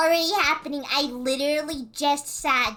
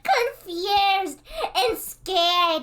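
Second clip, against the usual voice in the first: real laughing disbelief; 10 semitones higher than usual. Real, not acted. Streamer recalling being overstimulated and scared, laughing. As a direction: laugh while gushing about being overwhelmed. Laughing over her overwhelming memory.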